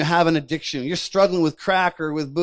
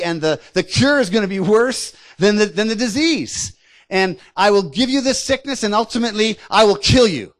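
higher, forceful threats from a bullying enemy; intimidating giant; 4 semitones higher, 4 dB louder than usual. Acted. Enemy giant claiming control over the sick, intimidating. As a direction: press each threat home like a gloating bully. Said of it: "he uses intimidation. He uses fear".

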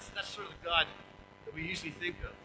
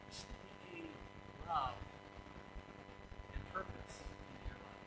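much softer, near whisper; hushed; 11 semitones lower, 15 dB quieter than usual. Real, not acted. Preacher describing God at the bedside, hushed. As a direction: draw them close with a hush.

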